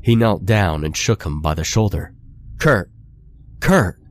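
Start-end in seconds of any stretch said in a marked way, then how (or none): 2.6-4.0 yelling a name. Acted. Connor shaking his fallen friend, urgent yelled calls. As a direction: shout him awake, urgently. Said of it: "he yelled".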